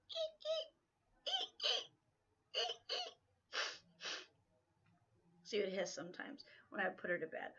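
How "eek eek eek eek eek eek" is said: very high, thin squeaky chirps; bird squeak. Retold or imitated. Mimicking her cockatiel's chirps for the viewers.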